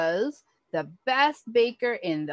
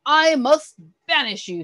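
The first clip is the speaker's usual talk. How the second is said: higher, louder stern decree; stern verdict; 6 semitones higher, 7 dB louder than usual. A narrator's reading. Duke sternly banishes Walter. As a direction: pronounce the sentence firmly. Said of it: "said the Duke".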